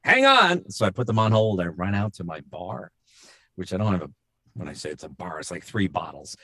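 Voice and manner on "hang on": high, louder retold call. Asking commercial clients to wait, calling out. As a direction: call out brightly to hold them.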